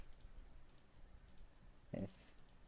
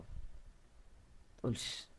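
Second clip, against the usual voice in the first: whisper; 10 dB quieter, much slower than usual. Real, not acted. Whispers to himself while hiding.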